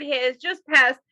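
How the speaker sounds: ordinary talk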